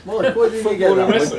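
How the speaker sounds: laughing. Real, not acted. Player laughing, teasing teammate.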